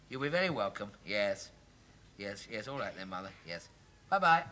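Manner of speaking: usual speaking voice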